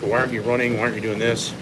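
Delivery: normal talking voice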